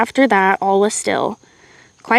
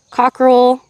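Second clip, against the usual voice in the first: ordinary delivery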